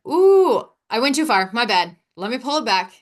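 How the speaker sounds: high, loud "ooh", then easing down; self-correcting alarm. Acted. Speaker aware she has overstepped her boundaries, acted self-correcting alarm. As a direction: catch yourself with a startled whoop, then back off. Acts out catching herself overdoing it.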